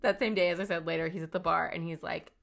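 ordinary talk, nothing marked

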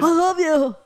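hammed-up love declaration; imitating Truman. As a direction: ham up a gushing sheriff.

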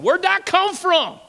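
high, slow wonder; astonished preacher; amazed. Real, not acted. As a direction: marvel out loud. Amazed at change.

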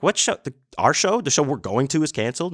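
can't believe the news, voice jumping up; shocked disbelief. Retold or imitated. Hearing show is canceled.

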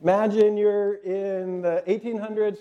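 ordinary talk, nothing marked

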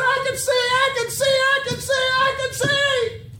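high, loud repeated cry of joy; exultant full-voice holler; jubilant shouting. Acted. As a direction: shout it over and over, ecstatic. Healed blind man rejoices.

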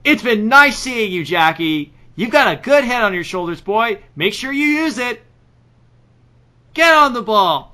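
high, loud, slow pitchman goodbye. Acted. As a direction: boom it like a TV ad host. Drunk Billy Mays farewell.

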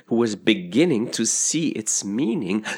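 plain reading voice

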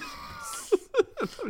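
high breathless laugh after shouting. Real, not acted. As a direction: crack up at yourself. Laughing at own impression.

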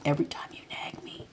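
hushed, unvoiced warning; a soft whispered threat to the son.

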